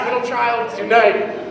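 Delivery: ordinary delivery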